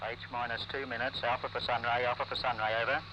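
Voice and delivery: tinny radio transmission, low and distant; crackly military radio. Real, not acted. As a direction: speak through a crackly field radio. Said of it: "Loudspeakers sounding harshly".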